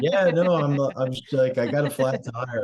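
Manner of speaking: high, loud, bluffing casual; overly casual cover. Retold or imitated. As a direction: play it cool while hammered, too loud. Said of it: "And I'm like".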